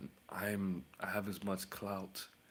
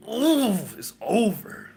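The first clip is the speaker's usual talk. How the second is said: gleeful squeal; 14 semitones higher, 13 dB louder, slower than usual. Acted. Gleeful about a revenge spell.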